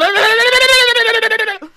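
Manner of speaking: high, very loud sustained gobbling cry; loud bird trill. Pretend. Co-hosts doing bird noises in a bit, loud trilling. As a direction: let out a long cartoon bird warble.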